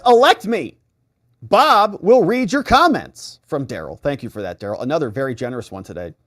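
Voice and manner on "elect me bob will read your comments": loud, high hammed-up slogan; mock campaign shout. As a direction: proclaim it like a rally promise.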